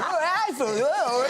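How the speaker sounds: one long high, loud Cockney vowel; exaggerated Cockney howl, consonants dropped; Cockney without consonants. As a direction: slur mock Cockney into pure vowels. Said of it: "No consonants going".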